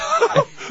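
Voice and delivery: squealed giggle; high burst of laughter. Real, not acted. Mid story, a sudden high laugh.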